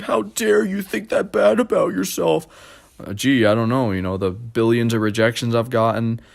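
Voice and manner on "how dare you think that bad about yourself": high, outraged mock mother; indignant mom. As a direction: scold him for putting himself down. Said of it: "She's like".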